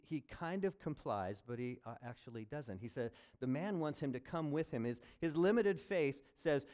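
normal talking voice

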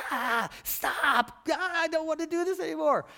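high, loud, strained mock outburst; exasperated outburst. Preacher playing someone who just wants to fight, exasperated. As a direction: act out someone fed up and lashing out.

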